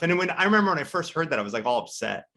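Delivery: normal talking voice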